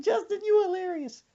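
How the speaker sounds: high, teary laugh-talk; grandma laughing. Retold or imitated. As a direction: squeak through tears of laughter. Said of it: "she said".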